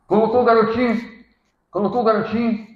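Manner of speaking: fervent sermon voice; forceful preaching. Acted. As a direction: proclaim it to the congregation.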